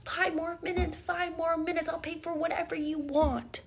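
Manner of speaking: imitated pleading. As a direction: beg softly for a little longer, mimicking him. Date pleading for more time.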